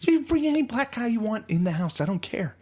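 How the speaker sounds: very high put-on dad voice; imitating small-town dad. Dad reassuring Shane, squeaky mock voice. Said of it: "they're like".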